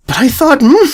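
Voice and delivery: high startled protest. Acted. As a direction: caught off guard. Startled colt cut off.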